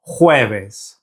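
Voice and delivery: an H sound for the J of "Jueves". Shown as a demonstration. Teacher asking learners to repeat, "Jueves" with J as H. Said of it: "That J in Spanish is pronounced like an H".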